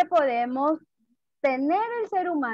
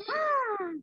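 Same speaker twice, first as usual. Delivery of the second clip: high, breathy surprised gasp; mock surprise; 7 semitones higher, 8 dB quieter than usual. Shown as a demonstration. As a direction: gasp as if shocked, for the photo. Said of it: "Ponte sorprendido para la foto".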